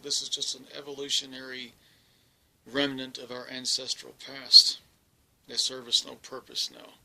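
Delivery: matter-of-fact lecturing tone; scientific. Retold or imitated. Alien explaining her fangs to the captive, clinical and matter-of-fact. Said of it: "very scientifically said".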